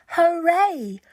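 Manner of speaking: loud, high joyful cheer; delighted scarecrows cheering; cheering hooray. Acted. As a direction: cheer it out with joy. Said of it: "cried the Scarecrows".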